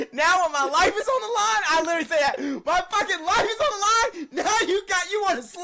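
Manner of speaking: mock yell about life on the line; retold yelling. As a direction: relive yelling at him, half laughing. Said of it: "I said".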